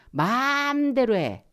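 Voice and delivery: loud, high, drawn-out 'do as you like'; exasperated parent. Acted. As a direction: snap it out, stretching the first word high and loud. 버럭하는 부모 말 흉내. (버럭하며 길게 빼는 부모 말투)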